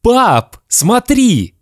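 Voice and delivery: put-on high, thrilled little boy calling out; breathless happy shout. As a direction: call to dad, bursting with joy. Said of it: "задыхаясь от счастья, закричал".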